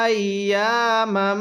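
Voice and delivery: drawn-out chanted recitation; slow melodic Quranic chant. Shown as a demonstration. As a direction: recite it like a tajweed teacher. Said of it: "তাহলে".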